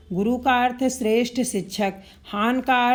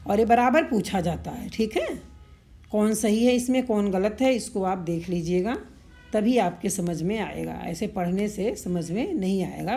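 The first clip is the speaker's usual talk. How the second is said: ordinary delivery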